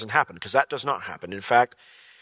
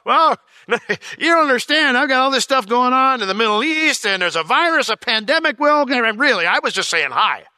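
mock-flustered Lord; 12 semitones higher, 7 dB louder than usual. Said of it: "Lord's like".

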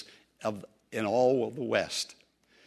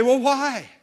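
much higher and louder, stunned why; bewildered plea; 11 semitones higher, 8 dB louder than usual. Retold or imitated. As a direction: cry out in disbelief. Pleading question at judgment.

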